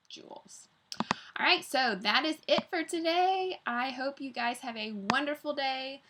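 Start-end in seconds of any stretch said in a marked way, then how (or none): 0.1-0.7 whisper. Real, not acted. Whispered name.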